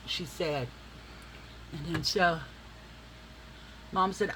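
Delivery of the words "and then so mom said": ordinary delivery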